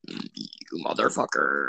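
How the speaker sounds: very low, slow and soft; low mock menace. Player cursing his scolding friend, deep slow mock threat. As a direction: drag it out deep and quiet.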